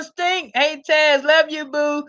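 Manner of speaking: ordinary talk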